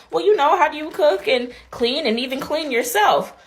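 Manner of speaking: lightly voiced nosy grandmother; mock old lady. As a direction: play the fussy grandma. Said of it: "she's like".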